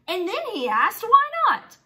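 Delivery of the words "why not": high, bright little mole rat asking; Wilbur's voice. Acted. Teacher voicing Wilbur for the class, high and curious.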